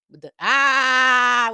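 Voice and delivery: slow, high, loud held yell. Retold or imitated. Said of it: "I'm saying it like [name]".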